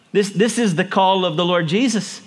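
ordinary talk

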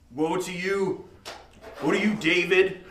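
playfully mad declaration; half-joking angry condemnation; mock angry pronouncement. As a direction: pretend to curse someone you're mad at. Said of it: "If you're mad at someone".

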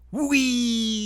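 high squeal of fun; gleeful wee. Retold or imitated. Moroku whirled around by the wind tunnel, squealing. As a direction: let out a high whee.

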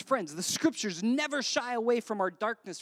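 ordinary delivery

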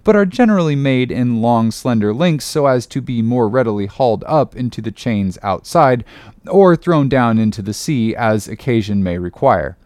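plain reading voice

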